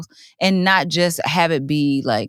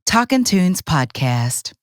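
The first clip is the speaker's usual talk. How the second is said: slow, drawn-out radio read; much slower than usual. Shown as a demonstration. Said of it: "Do it again, Lane".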